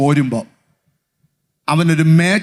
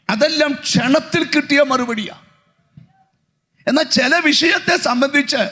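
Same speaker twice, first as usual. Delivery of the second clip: raised, pressing pulpit voice; fervent preaching; 6 semitones higher than usual. Acted. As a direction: preach it out to the hall. Raised pitch preaching run. (ആവേശത്തോടെ പ്രസംഗം)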